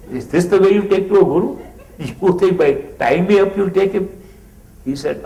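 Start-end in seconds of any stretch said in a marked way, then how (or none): 0.1-4.1 higher, louder, fast outraged protest; father shouting at his son for tying him to the bed, indignant and loud. Retold or imitated. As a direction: protest hotly at being tied up. Father protests being tied up.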